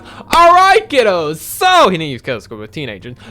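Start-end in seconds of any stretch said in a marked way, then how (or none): 0.0-1.4 high, loud hammed-up call to the class; mock teacher announcement. Choir teacher announcing the R rolling lesson, loud mock chirp. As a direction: imitate the teacher cheerfully addressing the class. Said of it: "my teacher, next day in class".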